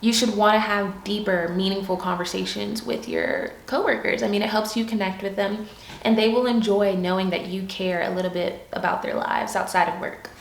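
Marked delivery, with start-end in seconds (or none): none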